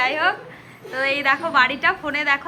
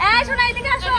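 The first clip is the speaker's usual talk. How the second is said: yelling across the room; 7 semitones higher, 4 dB louder than usual. Real, not acted. Calling someone across room.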